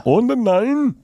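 high mock German accent. Showing the accent that terrifies him, mock German. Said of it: "German accent terrifies me".

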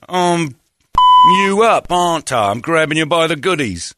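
hamming up a pleased flirt; mock cheeky lad.

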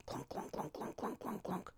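whispered clomping sounds; muttered clunks. Retold or imitated. Mimicking him stomping downstairs, whispered clunks. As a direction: breathe each clunk out under your breath. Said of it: "clomp down the stairs muttering".

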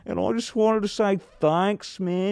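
put-on high, slow drunk thank-you; town drunk. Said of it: "and he'd be like".

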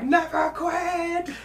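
very high, drawn-out scream; acted scream. Rigby screaming back at Muscle Man's goading, long shrieked reply. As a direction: stretch it into a high-pitched screech. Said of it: "to make Rigby yell".

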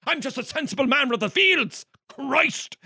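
very high, loud, laughing parody of a simple Irish farmer; hammed-up mock farmer. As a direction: ham up the humble farmer, giggling through it. Mocking the farmer hero's reply.

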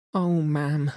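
high, upset wail of dismay; distressed maid. A narrator's reading. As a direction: cry it out, beside herself. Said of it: "She was crying and wringing her hands".

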